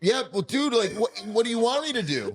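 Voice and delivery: high-pitched, exasperated protest; defensive. Real, not acted. Dad defending leaving the kids to friends, defensive. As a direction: plead your case, pitch shooting up.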